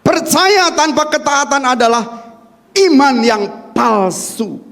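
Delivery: slow, weighty repeat for notes; measured dictation; slow emphatic repeat. Shown as a demonstration. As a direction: hammer it home slowly. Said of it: "Catat di sini".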